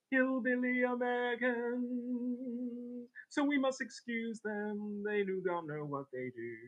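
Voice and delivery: high, loud mock-grand voice; put-on voice. Streamer mocking Americans in a high hammy voice. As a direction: declaim it in a silly high preacher voice.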